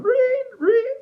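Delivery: very high, loud squeaky reet; guinea pig squeak. Retold or imitated. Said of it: "run away like a guinea pig".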